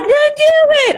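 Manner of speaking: very high, loud mock cheer; pep talk. Friend Talia urging her on the live, very high and loud. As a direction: squeal it like a cheerleader.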